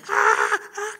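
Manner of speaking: loud acted wail; mock scream of desperate weeping; reenacted anguished scream. Driver beats the steering wheel, screaming and crying. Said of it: "saya teriak, saya nangis segila-gilanya".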